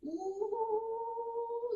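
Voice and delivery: long, high, held "ooh"; drawn-out ooh. Pretend. Presenter voicing woman getting worked up at Steve, high drawn-out ooh. Dubs her reply to Steve.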